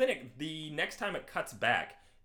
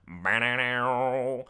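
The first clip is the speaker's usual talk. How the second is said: loud, drawn-out mouth guitar; imitated guitar riff; 8 dB louder, much slower than usual. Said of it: "that same little guitar riff".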